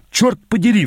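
sudden high-pitched outburst; exploding. A narrator's reading. Player losing patience with the general, exploding. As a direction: explode with exasperation. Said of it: "взорвался я".

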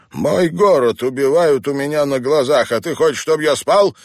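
loud, high-pitched outburst of outrage; furious. A narrator's reading. Sick teacher refusing to sleep while his town dies, furious. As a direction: protest hotly, speeding up into an incredulous question. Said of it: "яростно сверкнули глаза".

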